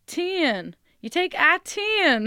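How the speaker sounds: high, loud and slow on "I ten"; emphatic yell. Retold or imitated. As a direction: shout the route from the back of the crowd. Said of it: "she goes".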